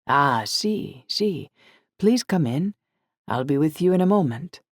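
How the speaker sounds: put-on light Italian shopkeeper accent; a friendly Italian welcome, lightly voiced. Glassblower welcoming guests at the door, light Italian accent.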